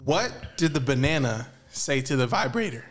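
ordinary delivery

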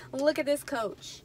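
ordinary talk

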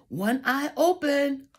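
usual speaking voice

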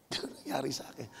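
fast, breathy laughing whisper. Real, not acted. To the congregation, whispered through a laugh. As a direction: let it slip out through a laugh.

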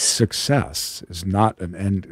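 deep, slow, hushed sage voice; profound voice. Pretend. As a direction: intone it like a wise guru. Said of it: "get my profound voice on now".

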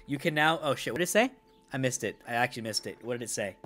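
ordinary talk, nothing marked

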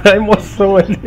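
laughing excuse. Real, not acted.

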